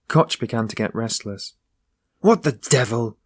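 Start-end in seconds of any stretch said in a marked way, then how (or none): 2.2-3.2 a mild impatient outburst; sudden cry. Acted. Koch impatient at the locked door, mild sudden cry. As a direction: blurt it out, lightly raised. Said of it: "he cried suddenly".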